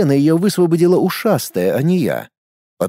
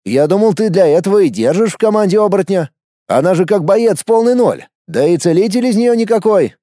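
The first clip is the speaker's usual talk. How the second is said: higher-voiced, animated friend; pitched-up character voice, protesting; puzzled Edmund; 6 semitones higher, 3 dB louder than usual. A narrator's reading. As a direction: reason it out in lively disbelief. Edmund justifies his assumption, astonished.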